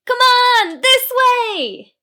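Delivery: very high, loud desperate scream. Acted. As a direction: call a friend to safety. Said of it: "while screaming to the goblin".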